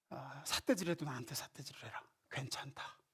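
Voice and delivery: soft whisper, tender and accepting; whispered reassurance. Acted. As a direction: hush it like a father comforting a child. God tenderly inviting complaint. (다정하게 속삭이듯)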